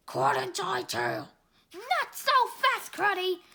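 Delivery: plain reading voice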